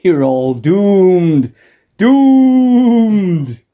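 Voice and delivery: very slow, rising to a wail; slow ominous raspy drawl. Pretend. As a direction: howl the doom like a prophet. Mock doom prophecy.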